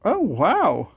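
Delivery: loud, stunned oh wow; can't believe your luck; amazed. Real, not acted. Amazed at unlikely find.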